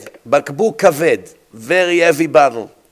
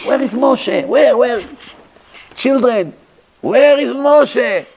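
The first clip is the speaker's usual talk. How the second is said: frantic search; 7 semitones higher than usual. Acted. Pharaoh searching at midnight.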